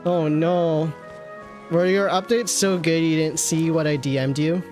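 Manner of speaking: usual speaking voice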